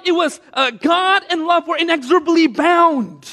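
high, loud, impassioned preaching; full-force preaching. Acted. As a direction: build the congregation to a peak.

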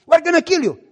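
high, loud threat to kill; death threat shout. Retold or imitated. Police shouting a death threat at father.

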